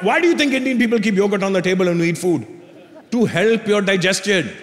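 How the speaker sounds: imitated dad's Indian accent; explaining Indian dad. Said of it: "My dad goes".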